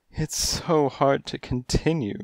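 breathed. A narrator's reading. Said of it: "she breathed".

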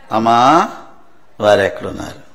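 low, tender address to a woman; Jesus. Retold or imitated. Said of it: "అప్పుడు యేసు ప్రభు". (లోతైన, మృదువైన స్వరం)

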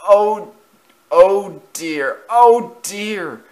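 high, loud, woeful moans; groaning. A narrator's reading. Engine backs up after the crash, pained groaning. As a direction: moan as if battered by a crash. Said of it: "groaned eight seven five four six".

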